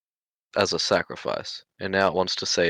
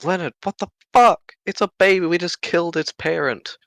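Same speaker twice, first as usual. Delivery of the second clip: raised, higher in-character outrage; indignant protest; 9 semitones higher, 5 dB louder than usual. Acted. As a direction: protest hotly on behalf of a helpless creature. Pleading for baby creature's life.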